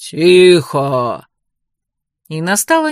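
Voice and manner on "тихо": low, loud booming command; thunderous voice. A narrator's reading. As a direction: silence the whole hall with one boom. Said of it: "громоподобный голос произнёс". (громоподобный низкий окрик)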